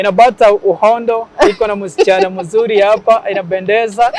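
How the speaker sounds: put-on Swahili with a Somali accent; upbeat Somali-accented Swahili presenter; Them Somali accent. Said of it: "imitate them Somali guy".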